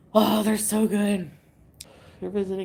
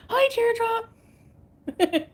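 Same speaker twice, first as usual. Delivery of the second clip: high greeting; 14 semitones higher, much slower than usual. Real, not acted. Greeting a chat viewer.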